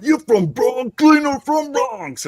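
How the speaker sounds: high, loud, slow mock New York accent. Swede imagining a New York waiter in a mock New York accent. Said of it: "next time in New York".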